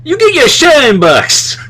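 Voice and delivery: high, slow Pesci taunt; Joe Pesci impression. Shown as a demonstration. Joking Pesci taunt to a friend. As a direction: taunt like a Goodfellas gangster. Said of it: "Joe Pesci".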